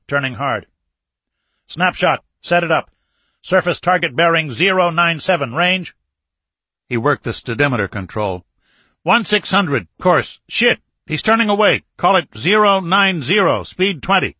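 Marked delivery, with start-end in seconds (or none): 1.7-5.9 high, loud attack orders; urgent commands. Acted. Captain calls target bearing to crew, urgent commands. 9.0-14.3 high, loud rapid-fire reporting; urgent callout. Acted. Captain at periscope as frigate turns away, urgent callout. Captain calls target course and speed.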